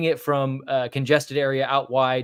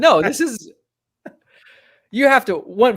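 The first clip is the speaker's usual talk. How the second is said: higher and louder, hammed-up urgency; mock fiery proclamation; 9 semitones higher, 7 dB louder than usual. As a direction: play it like a half-joking rallying cry. Mock proclamation about the neighborhood.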